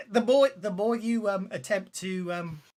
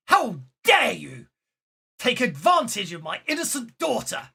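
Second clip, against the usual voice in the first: furious; 5 semitones higher, 9 dB louder than usual. Acted. Father confronting the man with his daughter, furious. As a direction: thunder it with indignation. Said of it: "a very furious individual".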